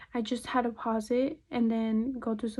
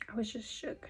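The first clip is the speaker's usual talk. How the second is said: hushed unvoiced whisper; whispered shock; 8 dB quieter than usual. Shown as a demonstration. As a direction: breathe it out like a secret.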